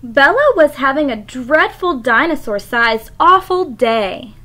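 lively picture-book read-aloud; expressive storybook narration; reading to children. Acted. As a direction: read it like story time for the class. Teacher reads picture book to class.

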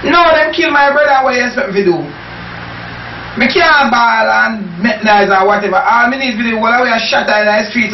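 usual speaking voice

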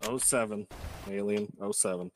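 usual speaking voice

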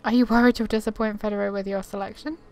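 normal talking voice

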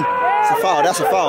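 protesting a foul. Real, not acted.

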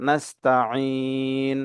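নাস্তাইন with a squeezed mid-throat ayn, held long; pressed throat sound. Shown as a demonstration. Teacher demonstrating the ayn, নাস্তাইন slow with pressure in the throat. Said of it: "গলায় চাপ দিয়ে পড়ব".

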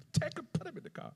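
high, hushed, quick command; quick, soft squeak of an order; mock urgent order. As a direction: imitate onlookers giving a hurried aside. Retells onlookers' order at the graveside.

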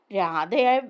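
normal talking voice

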